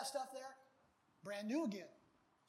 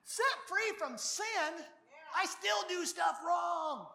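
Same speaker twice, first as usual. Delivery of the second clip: higher, slow, loud protest of a skeptic; slow loud incredulous objection; doubting believer; 6 semitones higher, 4 dB louder, much slower than usual. Pretend. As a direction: play the doubter crying out.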